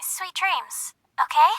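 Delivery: soft, hushed reassurance; tender goodnight. Acted. Caller offering support before hanging up, tender and soft. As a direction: trail into a gentle near-whisper.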